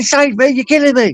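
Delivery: high, desperate plea from the friend; panicked victim. Retold or imitated. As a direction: imitate the mate crying out in fear. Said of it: "he's going".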